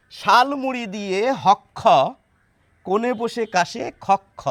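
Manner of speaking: ক্ষ read as "হক খ", a doubled k-kh rhyming with খক খ; rhyme with হক খ. Shown as a demonstration. Teacher reads the rhyme the right way for viewers, saying হক খ. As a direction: end the lines hok-kho and khok-kho so they rhyme. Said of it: "অন্তমিল কি পুরোপুরি এসে যাচ্ছে না".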